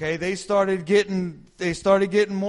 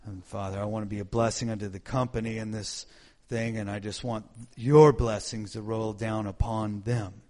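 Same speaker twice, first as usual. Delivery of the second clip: much lower, softer earnest prayer; quiet humble prayer; 7 semitones lower, 5 dB quieter than usual. Retold or imitated. Kneeling, praying to the Lord quietly and humbly. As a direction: pray low and humble, on your knees. Retold prayer on his knees.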